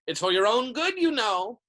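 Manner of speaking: high, loud sanctimonious lilt; saintly voice. A narrator's reading. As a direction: scold them with false virtue. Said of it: "in a saintly voice".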